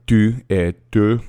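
tu et tu said slowly, two French vowels; French pronunciation. Shown as a demonstration. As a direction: slowly contrast tu et tu. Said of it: "to is early and tu is".

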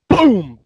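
shouted boom sound; loud boom effect. Retold or imitated. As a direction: bark out the door bang. Door kicked open in story.

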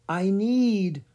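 high, drawn-out spectral tone; ghost voice. A narrator's reading. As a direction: intone it like a haunting spirit. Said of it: "the ghost said".

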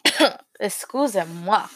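choking and coughing mid-word; choking on water. Real, not acted. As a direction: catch the breath after a choke. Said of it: "I'm choking on my water".

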